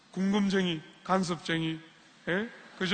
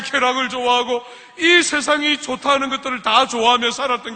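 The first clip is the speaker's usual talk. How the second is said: higher, louder, fervent sermon; preaching at full force; 6 semitones higher, 8 dB louder than usual. Acted. Pastor urging the congregation about adoption, loud fervent preaching. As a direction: drive it home to the congregation.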